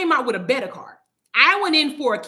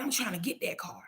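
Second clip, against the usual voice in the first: hushed, whispered aside; retold whisper; 12 dB quieter than usual. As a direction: whisper it as someone quietly wanting it. Retold covetous remark from others.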